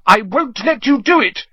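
high-pitched desperate stand; desperate defiance. A narrator's reading. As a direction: dig in, high and frantic. Steeling himself to do something desperate.

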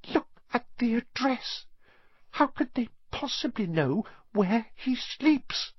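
high, trembling worried voice; quivering voice. A narrator's reading. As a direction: quaver with fear. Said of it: "in a quivering voice".

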